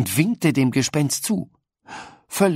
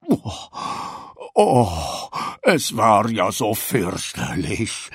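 wailing lament; 3 semitones lower, slower than usual. A narrator's reading. Sherlock the ghost laments his ordeal to Paula, slow wail. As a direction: moan it out, self-pitying. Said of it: "jammerte Sherlock".

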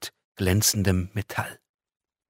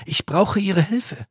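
much higher, slow earnest plea; pleading angel; 12 semitones higher, 5 dB louder, slower than usual. Acted. Angel begging the girl for help, slow and earnest. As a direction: ask slowly and earnestly for help. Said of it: "bittenden Gesichtsausdruck".

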